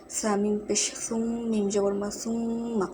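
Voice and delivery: সুম্মা with the m hummed through the nose; nasal gunnah. Shown as a demonstration. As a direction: hold the ম in সুম্মা as a long nasal hum. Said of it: "এখানে মিম গুণনা হবে".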